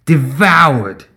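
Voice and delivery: grimly relished accusation; savoring the word. A narrator's reading. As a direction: linger on it for grisly effect. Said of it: "he seemed to savor the word".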